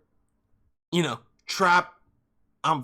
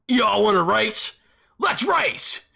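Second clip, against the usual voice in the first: louder, slow challenge then higher shout; hammed-up racer challenge; meathead racer; 5 semitones higher, 5 dB louder, slower than usual. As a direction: taunt like a roided-up racer. Racer guy voiced.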